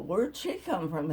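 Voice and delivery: ordinary talk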